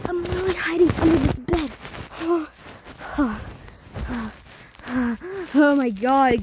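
ordinary delivery

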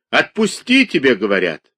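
enraged yell through the door; furious shout. A narrator's reading. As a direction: scream at the orderly. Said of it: "крикнул Иван Дмитрич".

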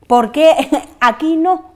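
por qué soltado fuerte y alto; reclamo enérgico. Real, not acted. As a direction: exige una respuesta en voz alta. Alcaldesa reclama indignada. (pregunta indignada en voz alta)

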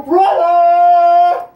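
loud high call. Acted. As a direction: call out brightly. Calling out to wake brother.

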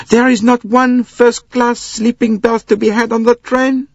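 put-on high, accented flustered concierge; concerned, apologetic. A narrator's reading. Concierge reports no berth on the train, high and apologetic. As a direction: protest helplessly in a high worried voice. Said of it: "He looked concerned and apologetic".

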